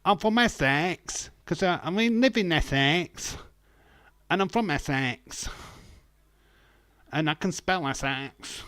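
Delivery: high, loud Essex lad voice; Joey Essex. Retold or imitated. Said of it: "Joey Essex".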